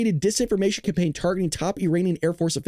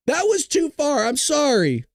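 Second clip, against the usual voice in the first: ordinary talk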